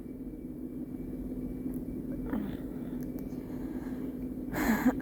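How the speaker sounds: long breathy sigh to recover; real, calming down after tears; shaky sigh. Real, not acted.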